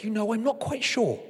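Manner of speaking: a hint of a seductive cake; coaxing cake. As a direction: tempt him like a cake offering itself. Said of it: "Cakey thought for a moment and said".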